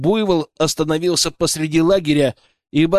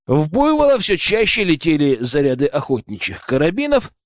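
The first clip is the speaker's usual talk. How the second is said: ordinary delivery, nothing marked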